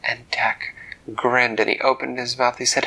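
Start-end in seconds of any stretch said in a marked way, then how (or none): none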